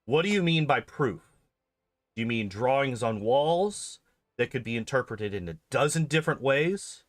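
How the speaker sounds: ordinary talk, nothing marked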